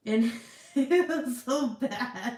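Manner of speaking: high, loud laugh through the words; slow, laughing exclamation; laughing in mortification. Real, not acted. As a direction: laugh while recalling how awful it was. Laughing at her embarrassing memory.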